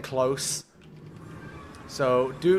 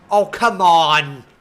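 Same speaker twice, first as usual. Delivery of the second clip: slow, louder cry of disbelief; frustrated yell; 9 dB louder, slower than usual. Real, not acted. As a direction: let it rip louder and slow. Another failed attempt.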